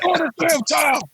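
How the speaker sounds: high, shouted mock corner-man yell; mock trainer shout. Telling the beaten kid to quit, in a mock shout. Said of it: "I'm like my man off, uh, Rocky".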